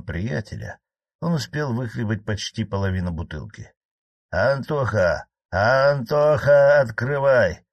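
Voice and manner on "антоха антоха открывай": put-on drunken slurred shout at the door; slurred drunk calling. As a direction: call out loud, tongue tripping with drink. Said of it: "заплетающимся языком пробормотал".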